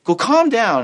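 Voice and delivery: high-pitched, forceful order; commanding. Acted. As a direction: order them to settle, acted. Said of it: "you're commanding them".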